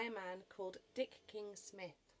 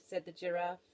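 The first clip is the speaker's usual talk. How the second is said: usual speaking voice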